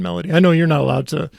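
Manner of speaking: usual speaking voice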